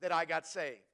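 normal talking voice

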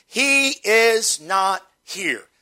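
HE IS NOT HERE drawn out very slowly; slow, weighty reading of "He is not here"; emphatic declaration. Shown as a demonstration. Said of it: "we can place emphasis on certain words".

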